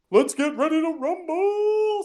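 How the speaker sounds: high, slow, drawn-out ring call; boxing announcer. Retold or imitated. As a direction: stretch it like a fight announcer. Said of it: "this sort of, like".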